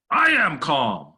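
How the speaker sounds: loud, drawn-out, high-pitched protest; indignant retort. Acted. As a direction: snap it back slowly and loudly, offended. Acts out reply to calm down.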